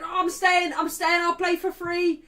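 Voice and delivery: high, loud mock excitement; imitating an overexcited footballer; eager player, acted. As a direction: plead to stay, hammed up and shouting. Acting out Casemiro's eager reply.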